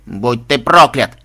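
slow, loud strangled curse; strangled growl. Acted. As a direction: growl it through clenched teeth. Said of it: "сдавленный рык".

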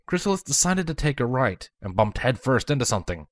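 ordinary delivery, nothing marked

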